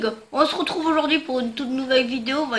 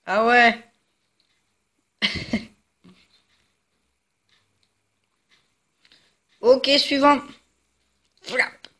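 cracked, hoarse voice; talking through a worn-out throat; slower than usual. Real, not acted. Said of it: "Désolé, j'ai la voix pétée". (voix cassée)

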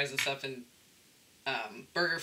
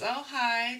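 sweet greeting; 9 semitones higher, 3 dB louder than usual. Retold or imitated. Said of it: "smiles and says".

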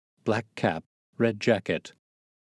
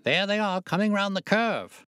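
high, thrilled spectator's call; rising racecourse excitement; excited cry; 12 semitones higher than usual. A narrator's reading. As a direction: point out the runners eagerly. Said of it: "he cried suddenly".

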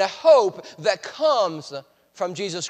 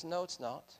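soft denial; 2 semitones lower, 14 dB quieter than usual. Retold or imitated. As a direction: say it softly, almost to yourself. Paul rebuts: no hope.

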